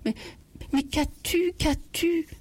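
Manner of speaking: bégaiement affolé, nettement plus aigu; bafouiller, la voix qui grimpe d'inquiétude; bégayant. Acted. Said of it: "Il bégaya". (le mari bégaie en voyant sa femme pleurer, voix aiguë)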